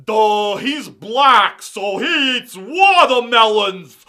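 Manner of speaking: loud, drawn-out dopey voice; mock dimwit. Showing what the joke is not, mock dimwit. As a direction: blurt it like an exaggerated idiot. Mocking a joke he denies making.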